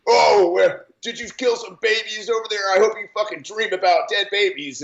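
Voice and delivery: high, sneering impression of a Twitter troll; mocking troll. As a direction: mimic the hateful reply with a mean sneer.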